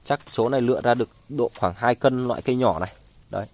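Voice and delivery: normal talking voice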